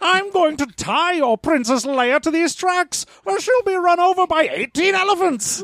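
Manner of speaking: very high, hammy villain voice; sneering panto villain. Acted. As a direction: threaten like a melodrama baddie. Villain tying princess to tracks.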